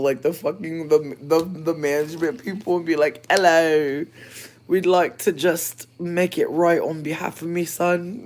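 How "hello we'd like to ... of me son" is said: loud, higher, slow mock British; hammed-up British caller; mock British accent. Pretending to phone the management, British accent. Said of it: "trying to pretend to be British".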